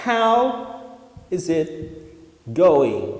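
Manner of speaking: slow demo. Shown as a demonstration. Teacher presenting a new greeting, slow and deliberate. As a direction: spell out each word of "How is it going". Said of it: "vamos lá".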